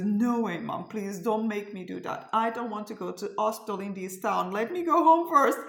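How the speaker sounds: retold protest rising to a high, loud plea; urgent, insistent pleading; pleading daughter. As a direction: imitate a daughter begging her mom. Daughter begging mother over the phone.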